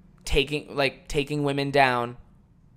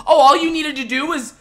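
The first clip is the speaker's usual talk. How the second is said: mocking quote; 13 semitones higher, 11 dB louder than usual. Mocking a commenter's advice.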